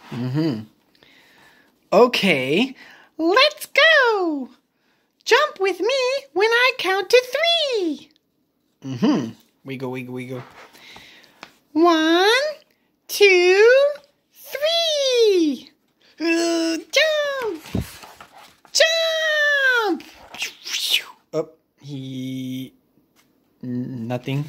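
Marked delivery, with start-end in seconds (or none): 3.2-8.1 high little pig voice; Piggy calling Gerald to jump, high voice. Acted. Piggy leading the dance. 11.7-20.0 high little pig voice climbing to a shout; Piggy counting Gerald into the jump, rising to a shout. Acted.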